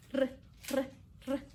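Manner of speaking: short separate "r" sounds, not the letter name; isolated letter sound. Shown as a demonstration. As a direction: say Spanish "r" alone, briefly. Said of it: "los sonidos".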